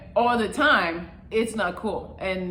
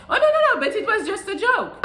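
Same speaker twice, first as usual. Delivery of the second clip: mock girlfriend; 10 semitones higher, faster than usual. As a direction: parody her innocent laugh, shrill. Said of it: "poking at you and trying to see how you react".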